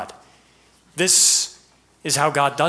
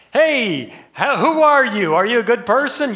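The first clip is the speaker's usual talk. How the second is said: mock cheerful question; 9 semitones higher, 6 dB louder than usual. Acted question to someone.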